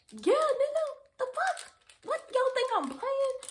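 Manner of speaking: high, loud, exasperated outburst; almost shriek it, fed up; heated insistence. Retold or imitated. Yelling at her friend that she isn't playing.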